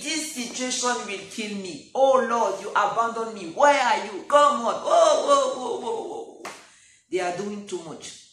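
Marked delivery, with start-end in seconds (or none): 0.0-4.7 loud, desperate wail at God; panicked cry to the Lord. Acted. Terrified disciple crying out to the Lord, loud and desperate. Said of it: "They started saying". 4.7-6.4 loud, high mock alarm; mock panic whoa. Preacher mimicking frightened disciples, hammed-up loud whoa.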